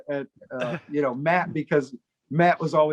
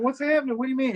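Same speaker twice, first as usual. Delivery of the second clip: higher-pitched puzzled questions; feigned alarm; 8 semitones higher, faster than usual. Retold or imitated. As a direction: play along as if worried. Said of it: "trying to play along".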